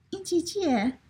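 put-on high little-kid voice; high, childish, insistent question. A narrator's reading. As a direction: pester in a small child's high pitch. Child asks yet again.

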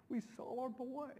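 rising, choked-up joy; emotional. Real, not acted. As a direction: relive the moment, voice lifting with emotion. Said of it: "I still just get so emotional".

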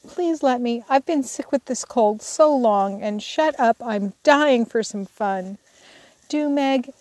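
put-on wheedling child's plea; pleading. A narrator's reading. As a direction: beg pitifully as a little sister. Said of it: "pleaded Amy, looking as pathetic as she could".

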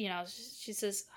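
whispered aside. Real, not acted. Whispering an aside while retelling the movie.

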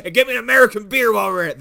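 loud, fast brash order; loud fast Tex demand. Acted. Tex demanding an American beer, fast and loud. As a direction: bark it out quickly.

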